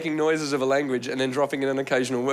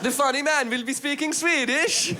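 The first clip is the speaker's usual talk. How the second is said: put-on high, excited Swedish accent; high, lilting Swedish accent; Swedish viewer; 11 semitones higher, slower than usual. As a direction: call the family over in mock Swedish English. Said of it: "And they would have been".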